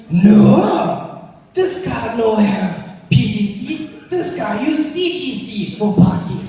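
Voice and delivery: lightly put-on South African salesman accent, broken English; salesman's accent. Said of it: "What the fuck with your accent".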